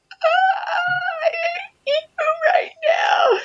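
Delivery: very high-pitched hammed-up crying squeal; exaggerated tearful wail, drawn out and strained; mock overwhelmed wail. Overwhelmed mom answering the cheerful caller, mock crying wail. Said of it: "so overwhelmed".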